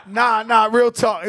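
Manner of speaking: real laugh breaking through the words. Real, not acted. Refusing to tell the story, laughing it off. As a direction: laugh and wave the story away. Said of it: "I can't tell y'all that story".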